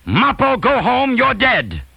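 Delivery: high, loud heckle across a crowded hall; big, high-pitched holler; yelled. Retold or imitated. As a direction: yell it out at the top of your range. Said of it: "He yelled".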